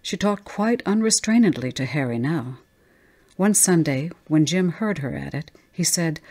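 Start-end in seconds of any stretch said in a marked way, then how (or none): none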